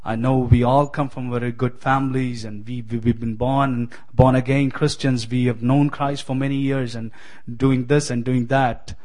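ordinary delivery

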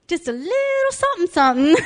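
very high, teasing Southern imitation; Southern accent. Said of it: "she literally went with her fingers".

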